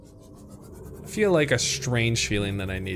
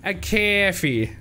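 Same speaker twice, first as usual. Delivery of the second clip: higher, slow, drawn-out "A coffee"; imitating his odd coffee; 7 semitones higher, slower than usual. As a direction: stretch out "coffee" slowly, mock-quoting him. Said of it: "why he said it like that".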